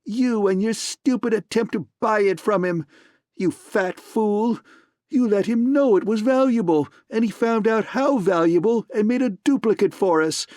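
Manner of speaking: furious man screaming insults at partner. A narrator's reading. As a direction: shriek insults in rage. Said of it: "he screamed at Gutman".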